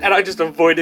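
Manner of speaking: ordinary talk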